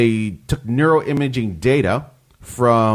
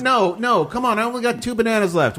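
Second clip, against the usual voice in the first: mock complaint; 11 semitones higher than usual. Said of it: "I was like".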